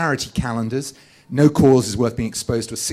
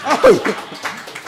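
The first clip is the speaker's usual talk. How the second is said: real laugh; 13 semitones higher, 4 dB louder than usual. Real, not acted. Laughing at audience.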